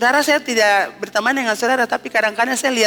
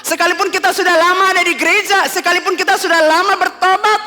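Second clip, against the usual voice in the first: louder, higher preaching at full force; 5 semitones higher, 4 dB louder than usual. Acted. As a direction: drive the call to repent home. Climax of the sermon. (berkhotbah dengan lantang dan berapi-api)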